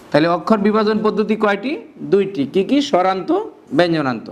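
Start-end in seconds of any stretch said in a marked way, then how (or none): none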